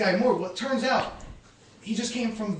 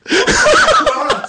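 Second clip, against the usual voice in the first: laughing; 11 dB louder than usual. Real, not acted. Bursts out laughing.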